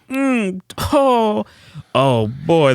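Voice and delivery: high, loud swooning moan. Acted. Swooning over salad with fries.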